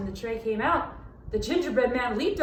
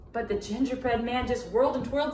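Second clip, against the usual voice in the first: usual speaking voice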